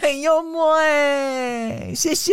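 stretched high laughing compliment; laughing praise. Real, not acted. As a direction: drawl it out through a laugh. (笑着拖长说)

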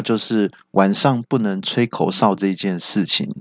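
ordinary talk, nothing marked

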